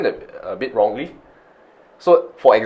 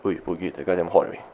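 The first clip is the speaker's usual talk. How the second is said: casual aside; 4 semitones lower, 10 dB quieter than usual. Shown as a demonstration. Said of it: "You see the way he say is very different".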